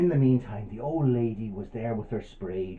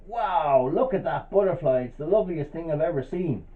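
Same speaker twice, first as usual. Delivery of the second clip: higher-pitched delighted wonder; awed old lady; 5 semitones higher than usual. Acted. Old lady spotting the butterfly, delighted amazement. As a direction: marvel at it in a raised voice. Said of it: "and she went".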